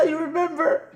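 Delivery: very high squeaky words through laughter; real laughing. Real, not acted. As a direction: ask while helpless with laughter. Still laughing at the memory.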